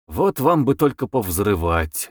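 plain reading voice

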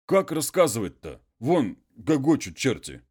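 a hint of a humble muzhik pleading; peasant voice.

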